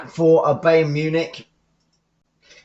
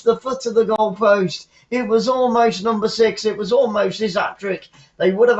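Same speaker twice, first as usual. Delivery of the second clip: much higher and louder, shouting the near miss; excited commentary; 9 semitones higher, 4 dB louder than usual. Real, not acted. Commentator calling Lewandowski's near hat-trick, excited shout. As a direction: call it like the ball just rattled the post.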